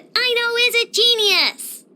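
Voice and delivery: proud, emphatic self-praise, voice lifted; proud boast. Acted. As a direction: announce your own genius with a flourish. Character boasts of own genius.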